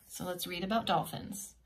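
ordinary delivery, nothing marked

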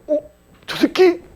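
high-pitched mock double take; acted surprise. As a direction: act suddenly recognising someone you know. Acts spotting ex-student on the news.

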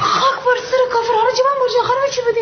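high, loud, rushed and frantic; panicked neighbour cries out over the locked door; frantic cry. Acted. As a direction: blurt it out in a fast panic.

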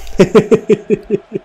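helpless run of chuckles; disbelieving laugh. Real, not acted. Gamer losing it over the game, helplessly laughing. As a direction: laugh at the absurdity.

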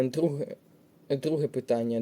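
ordinary talk, nothing marked

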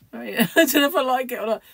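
speaking through a laugh. Real, not acted. Laughing about her own hair.

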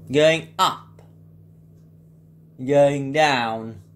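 slow, measured elevator floor announcements; older Ecodisc voice. Shown as a demonstration. Impressionist demos the older Ecodisc lift, slow announcer voice. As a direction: call out each floor like an old lift recording, falling at the end. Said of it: "old Ecodisc voice impression".

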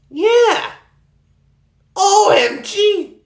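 O M G drawn out in delight; excited cheer. Real, not acted. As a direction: celebrate the win. Celebrating in game.

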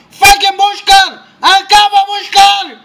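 very high, loud panicked yelling; frantic shouting. Real, not acted. Gamer in a firefight yells to his teammate, frantic. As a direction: scream it mid-firefight. Calling teammate during a fight.

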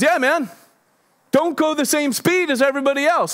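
high-pitched mock punk kid. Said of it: "he's a punk kid".

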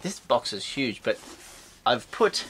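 usual speaking voice

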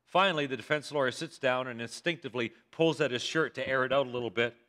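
usual speaking voice

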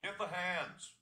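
high, loud call to the farm hands; farmer shouting. Acted. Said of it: "the farmer shouted".

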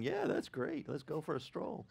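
preacher mocking a cheerful stroll through death's valley. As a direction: pretend eager, dripping irony. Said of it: "Anyone here going".

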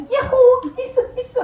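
high-pitched happy cheering; gleeful whoop. Acted. Younger son leaving his father with money, gleeful whoop. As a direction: play a giddy, high-voiced celebration.

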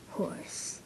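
low eerie whisper; creepy whisper. Acted. Calling the horse, a creepy whisper. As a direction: breathe the word creepily. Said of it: "don't say it like that. It's creepy".

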